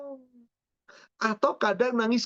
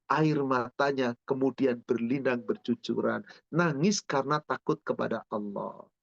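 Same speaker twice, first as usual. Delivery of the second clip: tender hushed; 8 semitones lower, 3 dB quieter than usual. Acted. Preacher describes tears in night prayer, hushed and tender. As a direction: lower and softer, tender.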